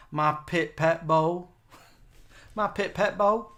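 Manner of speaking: slow Southern accent, vowels swapped, "my pit pet bull" with no L. Shown as a demonstration. Host demos her Southern accent, slow, L's dropped. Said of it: "I flip the vowels round and take off the L's".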